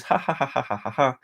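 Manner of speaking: spoken run of dry ha-ha-ha; deliberately fake, derisive laughter; mocking fake laugh. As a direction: laugh mockingly at whoever is wrong. Mocking those who are wrong.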